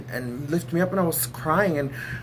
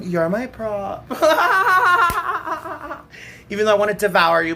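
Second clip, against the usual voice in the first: laughing tease; 6 semitones higher, 7 dB louder than usual. Acted.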